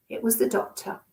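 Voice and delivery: ordinary delivery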